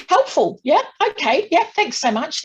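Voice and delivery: high, loud mock-cheery sarcasm; sarcastic thanks. Sarcastic reply to doctor.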